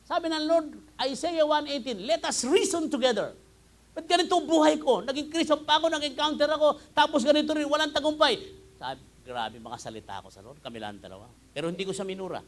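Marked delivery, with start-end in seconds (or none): 0.1-8.4 loud, much higher preaching cry; full-force preaching. Acted. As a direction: preach it loud to the back row. (malakas at mataas na pangangaral)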